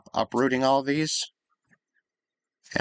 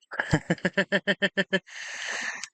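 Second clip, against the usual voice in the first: high rapid-fire giggle; 8 semitones higher than usual. Real, not acted. Amused at the character viewing mushrooms, high giggle. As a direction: let out a long high giggle.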